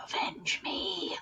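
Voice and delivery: slow, breathy ghostly whisper. Acted. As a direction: whisper it like a vengeful ghost from beyond. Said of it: "A ghostly voice".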